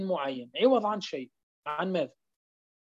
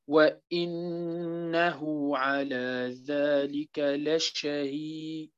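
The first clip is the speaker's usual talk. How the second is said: slow, soft tajweed chanting; Quran recitation; 4 semitones lower, 4 dB quieter, much slower than usual. Acted. Teacher recites surah al-Adiyat to students, slow soft chant. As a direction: recite the verses slowly and quietly.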